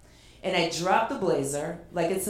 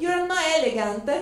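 high, louder mock scolding; mock self-scold; 10 semitones higher, 4 dB louder than usual. Mocking her own wobbly runway walk, high and loud. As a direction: tease yourself for the clumsy walk.

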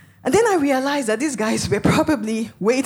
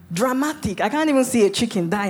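ordinary talk

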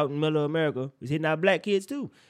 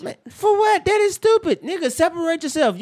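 loud, high-pitched indignant retort; real heated disbelief; exasperated outburst; 13 semitones higher, 6 dB louder than usual. Real, not acted. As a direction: shoot it down, voice jumping way up. Heated retort to co-host's excuse.

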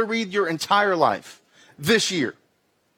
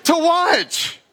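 high, slow, frustrated outburst; exasperated complaint; 8 semitones higher, slower than usual. Retold or imitated. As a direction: whine it like a fed-up viewer. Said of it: "I'm like".

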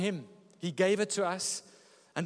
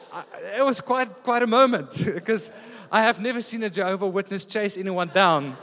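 usual speaking voice

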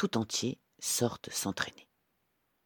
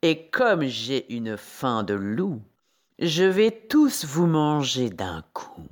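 slow, relishing wolf, building louder; gleeful wolf; 5 semitones higher, 5 dB louder, slower than usual. A narrator's reading. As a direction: savour the coming meal, growing bolder. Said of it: "il s'exclame tout joyeux". (loup tout joyeux, gourmand)

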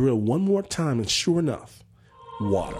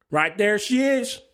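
higher, triumphant farmhand call; farmhand's announcement; 6 semitones higher than usual. Retold or imitated. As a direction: announce the find as an old farmhand. Farmhand announces the water spot.

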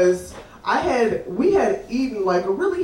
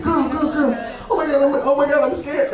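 a touch of panic, slightly high; mock scared; 3 semitones higher, faster than usual. Friend peeing in a bush spots skunks, mildly scared. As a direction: imitate a frightened friend repeating herself. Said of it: "scared at the same time".

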